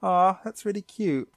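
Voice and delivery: mock aww. Host reacting to Misty hugging Psyduck, cooing. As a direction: coo it like seeing a puppy. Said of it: "I'm like".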